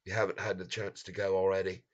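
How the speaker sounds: ordinary talk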